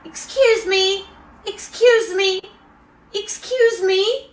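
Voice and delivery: put-on slow, polite little kid asking for attention; timid child politely repeating himself, pitch creeping up; polite four-year-old. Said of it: "her son is four years old".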